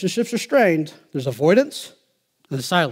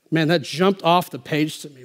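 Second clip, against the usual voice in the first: ordinary talk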